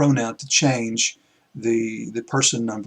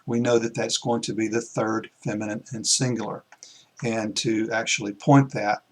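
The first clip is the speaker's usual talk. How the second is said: ordinary talk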